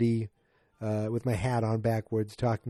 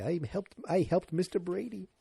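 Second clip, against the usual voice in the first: happy; 6 semitones higher, 4 dB quieter than usual. Retold or imitated. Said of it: "she's all, like, happy".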